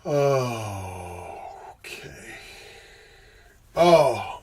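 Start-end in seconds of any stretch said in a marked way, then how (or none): 0.0-2.4 whisper. Real, not acted. Whispered aside.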